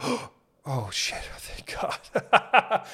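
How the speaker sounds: gasping wake-up. Pretend. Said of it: "You're like".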